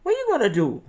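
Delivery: high, loud mocking mother's voice; mock-scolding mom, high and loud, laughing through it. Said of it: "she used to say".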